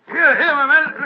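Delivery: calling out loudly. Acted. A doctor calling to a stranger who slipped on ice, loudly. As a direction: shout over to get his attention.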